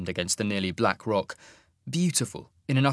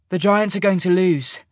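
higher, louder woman's voice, urgent; young woman, urgent; 8 semitones higher, 6 dB louder than usual. Acted. Sasha to the group, a higher urgent report. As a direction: report what you see urgently.

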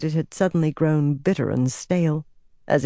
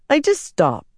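put-on frightened boy; 10 semitones higher, 3 dB louder than usual. A narrator's reading. Boy's panicked reply.

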